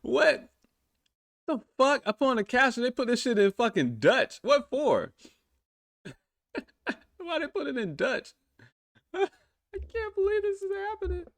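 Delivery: very high, squeaky incredulous questions; can't believe what he's seeing; stunned disbelief. Real, not acted. Captions came out in Dutch.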